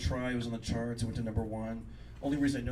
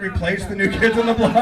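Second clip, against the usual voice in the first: loud, higher, laughing through the words; laughing loud retold line; 7 semitones higher, 14 dB louder than usual. Comic retells his onstage blunder, loud and laughing. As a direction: blurt it out again, cracking up.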